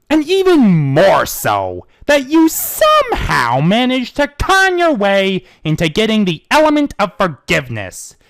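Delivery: loud, slow accusation; accusing. Acted. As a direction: drag out the charge coldly. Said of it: "he continued".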